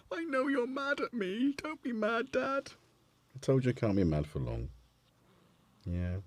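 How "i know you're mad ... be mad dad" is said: high, soft pleading kitten voice; voicing the cat. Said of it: "He's like".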